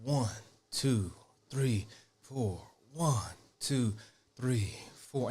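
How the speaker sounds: low, measured count in step; slow walking-beat count, dropped low; counting to a pace. Shown as a demonstration. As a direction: count evenly, one word per footfall. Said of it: "this pace right here".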